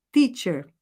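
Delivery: teacher with a pure T, "tee"; correct teacher. Shown as a demonstration. Teacher to students as the correct model, with a pure T. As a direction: say teacher with a clean T, as in teu. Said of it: "Então é".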